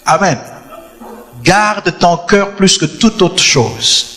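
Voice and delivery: ordinary talk, nothing marked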